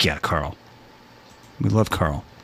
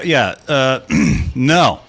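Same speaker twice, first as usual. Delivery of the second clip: usual speaking voice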